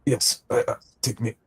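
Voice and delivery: weak, high, failing voice; barely able to ask for help; frail old man. Acted. Weak priest asks to be led.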